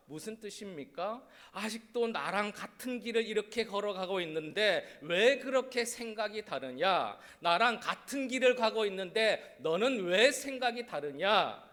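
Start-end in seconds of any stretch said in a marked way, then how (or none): 1.5-11.6 louder, pressing rebuke to his disciples; a teacher scolding his followers, louder; Jesus rebuking. Retold or imitated. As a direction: ask why their thinking differs. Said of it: "이렇게 물어보시는 거예요".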